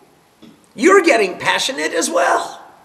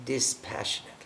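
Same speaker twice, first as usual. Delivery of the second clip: breathy whisper of the word dispassionately; whispered aside; 11 semitones lower, 15 dB quieter, much faster than usual. Shown as a demonstration. Contrasting passion with dispassion.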